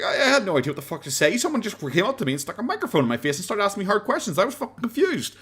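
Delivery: acting a confused man protesting his innocence; flustered random guy. Random guy defending himself after being shit on, flustered. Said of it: "everyone shit on him for that".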